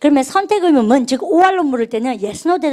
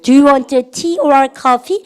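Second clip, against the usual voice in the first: very slow, each word drawn out for repeating; slow model reading; 3 dB louder, much slower than usual. Shown as a demonstration. Teacher reading slowly for students to repeat. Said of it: "시작".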